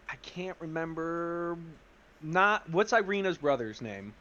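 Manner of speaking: ordinary talk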